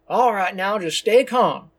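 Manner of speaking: loud, high stern robber voice; stern command. A narrator's reading. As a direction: order the hostages to stay calm. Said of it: "a stern voice commands".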